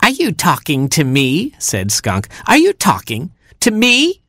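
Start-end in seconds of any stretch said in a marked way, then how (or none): 0.0-1.5 high, challenging skunk question; affronted Skunk. A narrator's reading. Skunk to defiant Hamster, affronted. 2.5-4.2 much higher, incredulous skunk question; outraged Skunk. A narrator's reading. Skunk to defiant Hamster, escalating outrage.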